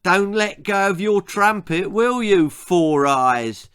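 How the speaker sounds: high, loud jeering taunt; a bully taunting a boy with his glasses out of reach. Acted. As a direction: dangle it and jeer. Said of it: "taunted Johnny".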